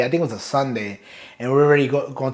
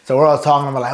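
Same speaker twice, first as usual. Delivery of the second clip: ordinary delivery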